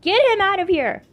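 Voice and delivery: demanding the dog be removed, loud. A narrator's reading. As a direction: order it out shrilly.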